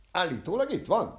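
high, loud agitated cry; shouting. Acted. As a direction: shout it out in distress. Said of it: "kiáltott fel a férfi". (magas, hangos, izgatott kiáltás)

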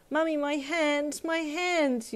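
put-on high, drawn-out little girl's wail; distressed child's scream. A narrator's reading. Glued child crying to her mum, acted scream. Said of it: "you screamed".

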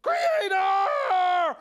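high, loud, slow alarm call; yelling crowd. Acted. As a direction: shout the alarm to the creator. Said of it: "So everybody yelled".

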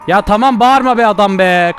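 loud fed-up protest; exasperated shout. Pretend. Player tells yelling grandpa off, loudly exasperated. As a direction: snap loudly at a shouting man.

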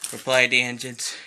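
ordinary delivery, nothing marked

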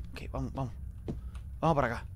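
low, very soft murmur; hushed. Real, not acted. Player creeps away from the nearby witch, hushed. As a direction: keep it down while sneaking.

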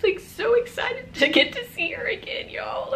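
high, cracking tearful voice; excitement tipping into crying; fighting back tears mid-sentence; voice breaking into tears. Real, not acted. Talking about dead sister, voice cracks.

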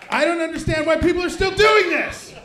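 high, loud ranting shout; comic outrage, shouted high; exasperated rant. Acted. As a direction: yell it in disbelief at the crowd. Ranting at parents having kids.

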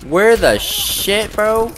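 high, loud, slow startled cry; startled yell. Real, not acted. Gamer yelling at a sudden shot, startled and loud. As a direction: jump out of your skin mid-match. Startled shout at enemy fire.

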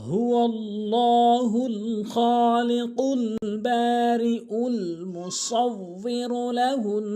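slow, drawn-out chanted recitation; Quran recitation. Shown as a demonstration. Teacher reciting the verse slowly for students.

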